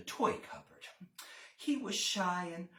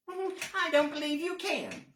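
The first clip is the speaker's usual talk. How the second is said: high doubting bunny voice; teasing rabbit; 10 semitones higher, 4 dB louder than usual. Acted. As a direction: doubt him like a cheeky rabbit.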